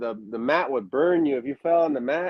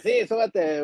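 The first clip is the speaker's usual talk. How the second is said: higher put-on trainer voice, insisting; Super Mojado impression; 5 semitones higher than usual. Trainer insisting the students climb up, higher put-on voice. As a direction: impersonate the trainer pushing them on. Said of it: "why the voice change?".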